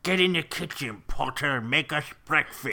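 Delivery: loud, hammed-up British accent; Vernon Dursley. Uncle ordering Harry into the kitchen, hammed-up British accent. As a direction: bark it in a bad British accent. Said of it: "what Vernon Dursley sounded like".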